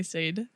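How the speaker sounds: "[name]" as a single syllable, sayd; spelling-style pronunciation. Shown as a demonstration. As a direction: rhyme "[name]" with made. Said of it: "exactly why I say [name]".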